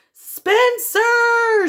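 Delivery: very high, loud scream of a name. A narrator's reading. Mom finds toys everywhere, screams. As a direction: shriek her son's name. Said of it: "she screamed".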